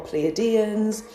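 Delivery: "Pleiadians" starting "plee-uh", with the weight on DEE; plee-uh-DEE-ans pronunciation. Shown as a demonstration. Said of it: "you can either say".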